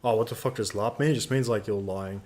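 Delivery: ordinary talk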